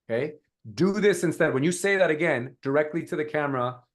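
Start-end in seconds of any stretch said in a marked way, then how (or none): 0.7-1.5 raised, emphatic "this"; stress on THIS. Shown as a demonstration. Coach speaks directly to the camera, stressing "this". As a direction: lift and punch the word "this". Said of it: "emphasis on this".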